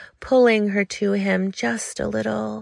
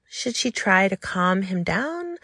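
ordinary delivery

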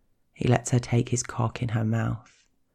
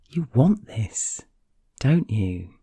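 incredulous; slower than usual. A narrator's reading. He asks her if she wants the monster, incredulous. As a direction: draw it out in astonished disbelief. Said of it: "he asks incredulously".

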